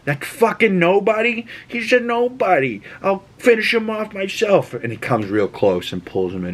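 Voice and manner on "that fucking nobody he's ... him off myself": high, with "nobody" spat out; a boss scoffing at his son's victim; mock raging mob boss.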